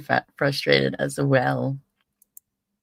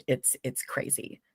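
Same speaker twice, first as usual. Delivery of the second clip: whisper; 3 semitones lower, 10 dB quieter than usual. Real, not acted. Muttered awe at Southern parades.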